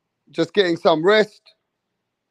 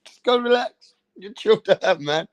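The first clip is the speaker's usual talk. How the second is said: coaching referee; 8 semitones higher than usual. Retold or imitated. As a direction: gently reassure him like a coach. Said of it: "He's letting him know".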